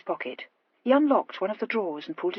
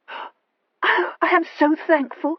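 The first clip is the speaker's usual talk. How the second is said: high, tearful relief; on a sob; 9 semitones higher, 6 dB louder, much slower than usual. A narrator's reading. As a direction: cry out your thanks on a sob. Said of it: "cried Horatia on a sob".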